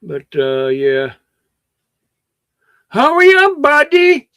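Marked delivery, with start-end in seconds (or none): 2.9-4.3 high, loud call to hurry; loud high-pitched hurry-up call. Real, not acted. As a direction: call him over from across the room. Calling someone to hurry up.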